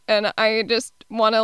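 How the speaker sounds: ordinary delivery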